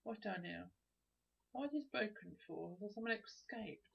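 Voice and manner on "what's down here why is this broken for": ordinary talk, nothing marked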